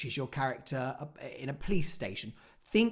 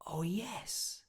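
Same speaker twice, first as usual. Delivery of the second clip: hushed, higher-pitched realization; moment of realization; 4 semitones higher, 10 dB quieter than usual. Acted. Actor reaching the climax of a monologue, hushed realization. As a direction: let it dawn on you, very softly. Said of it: "a moment in it where there's a climax".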